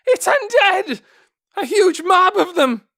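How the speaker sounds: high, loud terrified scream; trembling scream. A narrator's reading. A guard on the stairs warns the others, terrified scream. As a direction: scream the warning down, shaking. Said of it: "the guards screamed back".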